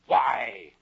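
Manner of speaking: loud, imperious one-word challenge; caterpillar's brusque demand. Acted. Storyteller as caterpillar questioning Alice, brusque and loud. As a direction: boom the question out. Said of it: "said the caterpillar".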